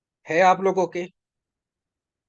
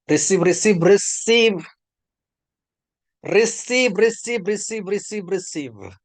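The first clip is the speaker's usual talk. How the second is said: raised, insistent repeated cry, easing at the end; fervent command; 4 semitones higher, slower than usual. Real, not acted. Preacher bidding listeners receive blessing, fervent rising cry that subsides. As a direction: fire it out urgently, then let it fall.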